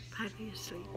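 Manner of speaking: soft whisper, barely voiced; whispering over a crib. Real, not acted. Baby just fell asleep.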